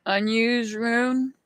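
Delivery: slow, tentative try at "Unused"; "Unused" drawn out as a careful guess; unsure pronunciation. Real, not acted. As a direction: sound out "Unused" slowly, unsure how it is said. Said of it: "I can never pronounce these things".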